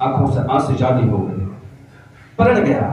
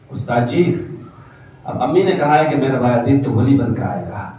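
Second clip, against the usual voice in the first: usual speaking voice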